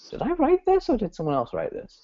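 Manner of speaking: high-pitched mock bewilderment; baffled client. Imagined client reads copy written in their voice, baffled. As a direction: pitch right up in puzzled disbelief.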